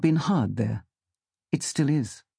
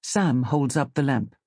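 plain reading voice

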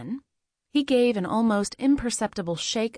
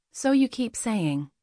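plain reading voice

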